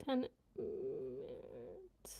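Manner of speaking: low, slow, soft spelling out of ten merit; drawn-out reading of a new word; slow careful sounding out. Shown as a demonstration.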